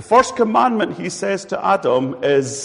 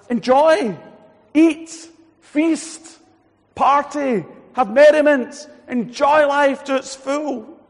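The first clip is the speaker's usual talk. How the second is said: exuberant command; 11 semitones higher, 6 dB louder, much slower than usual. Retold or imitated. God's first command retold.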